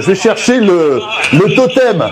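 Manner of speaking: excited. Real, not acted. Excited dash to fetch totem.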